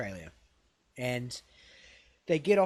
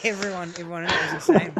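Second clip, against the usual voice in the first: higher and louder through laughter; amused disbelief; 4 semitones higher, 8 dB louder than usual. Real, not acted. Host summing up Tiger King, laughing incredulously. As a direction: laugh out loud as you say it.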